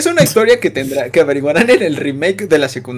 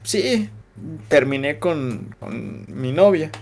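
softer, slow, downcast answer; mock sad confession; 4 semitones lower, 7 dB quieter, much slower than usual. He imitates his teen self telling the teacher about the breakup, sad and soft. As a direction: confess the breakup quietly and slowly. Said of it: "Y yo como de".

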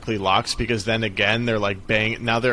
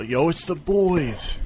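retold yell; 7 semitones higher, slower than usual. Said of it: "we would yell".

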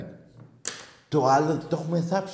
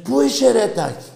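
high, raised call across the street; retold street hail; 10 semitones higher than usual. Passersby accosting the host in the street, high hailing call. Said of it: "Μου λένε".

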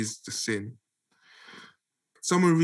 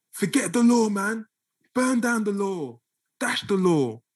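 higher-pitched, emphatic mock dismissal of someone rejecting the law; dismissive objector; 8 semitones higher than usual. Imagined objector telling you to drop the law, mock dismissive. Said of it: "if you hear someone say to you".

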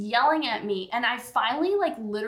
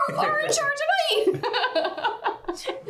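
high mock retort. Retort to dad.

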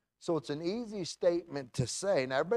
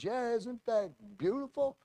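South Philly Italian voice; 8 semitones higher than usual. Retold or imitated. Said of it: "he's a South Philly Italian".

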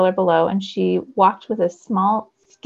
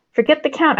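loud, higher call to go; hollered; 6 semitones higher, 4 dB louder than usual. A narrator's reading. Zane hollering to the others in the wind, loud. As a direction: holler it over the storm. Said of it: "I hollered".

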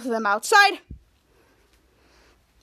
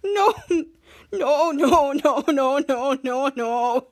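laughing-crying string of no, fading; hammed-up sobbing; mock sobbing no's; much slower than usual. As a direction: imitate a blubbering sob, trailing off. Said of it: "Schwoz was crying".

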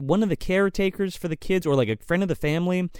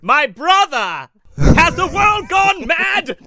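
very high, loud, slow anguished cry; overacted gothic despair; hammed-up theatrical wail; melodramatic hero; 12 semitones higher, 7 dB louder, much slower than usual. Victor voiced melodramatically.

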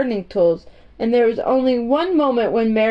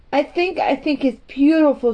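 determined; 5 semitones higher than usual. A narrator's reading. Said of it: "in a determined voice".

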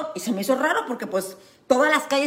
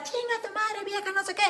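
high, mocking curse tossed off; half-hearted imitation of a parting insult; retelling the drunk man swearing at her as they drove off; 8 semitones higher, 9 dB quieter than usual. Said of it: "me empieza".

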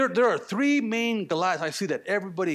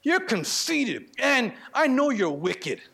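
drawn out slow and higher, each word accusing; slow accusation; 3 semitones higher, slower than usual. Acted. Older brother accusing David, slow and scornful. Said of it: "He called him conceited".

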